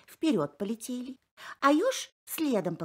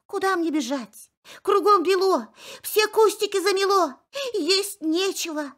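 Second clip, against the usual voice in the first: anxious hare; 10 semitones higher, 6 dB louder than usual. Acted. Frightened hare complains.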